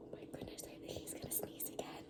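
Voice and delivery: soft whisper to listeners; whispering aside. Real, not acted. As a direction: lean in and whisper the secret.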